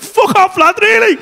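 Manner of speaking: high, loud Scouse outburst; rough Scouse. Retold or imitated. Salesman dropping his polite act, rough Scouse. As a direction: mimic a startled salesman in broad rough Scouse. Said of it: "full on rough ass scouse".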